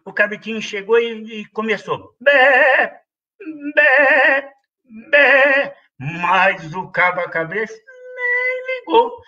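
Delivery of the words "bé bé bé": short loud goat bleats, pitched up; bleating goat. A narrator's reading. Little goat calling at the door, loud bleats.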